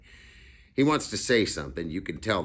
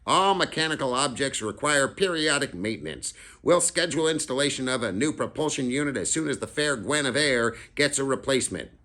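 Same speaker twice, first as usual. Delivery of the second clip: put-on know-it-all kid; 8 semitones higher than usual. Freak explains the broken bird, higher and formal. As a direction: speak like a pompous engineer. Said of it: "he says something like".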